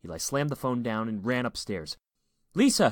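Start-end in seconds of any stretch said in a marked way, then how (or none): 2.5-2.9 high, loud shout of a name; urgent call. A narrator's reading. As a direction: call upstairs to your daughter urgently. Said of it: "he called as he ran".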